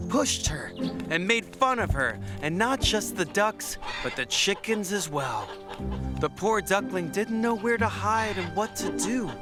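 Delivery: plain reading voice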